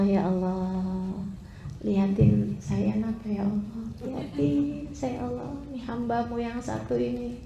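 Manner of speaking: soft, low, pleading prayer to God; tearful prayer. Acted. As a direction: beg quietly, almost weeping. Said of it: "manggil nama Allah". (doa lirih memohon dengan suara rendah dan pelan)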